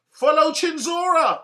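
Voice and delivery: loud sarcastic rallying cry; mock rallying call. Mocking call to follow Chisora.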